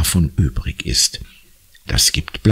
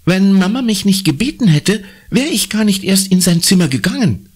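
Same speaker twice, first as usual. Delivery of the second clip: put-on higher, complaining girl; lightly voiced girl; 12 semitones higher than usual. A narrator's reading. Conny beschwert sich bei Papa, höhere Mädchenstimme. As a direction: protest to dad.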